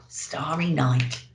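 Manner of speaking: ordinary delivery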